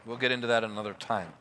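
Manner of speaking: ordinary talk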